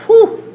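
loud, high whoosh of relief; relief whew. Retold or imitated. After dodging the guy he cheated, a loud high whew. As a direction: blow out a big mock sigh of relief. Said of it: "I walk away and I go".

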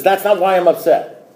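retold disbelieving comeback; mock-indignant protest; indignant challenge. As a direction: throw the sponsor's line back, affronted. Retold back-and-forth with sponsor.

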